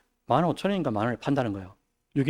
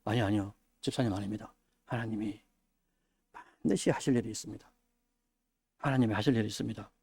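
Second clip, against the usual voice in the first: soft reassurance; 5 semitones lower, 11 dB quieter than usual. Retold or imitated. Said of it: "누군가에게 무슨 일이 생겨도".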